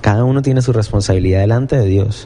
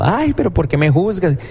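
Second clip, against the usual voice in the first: mock whine, pitched higher; whiny offended voice; 6 semitones higher than usual. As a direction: play someone hurt by being called out. Imita a persona ofendida. (queja ofendida y burlona, más aguda)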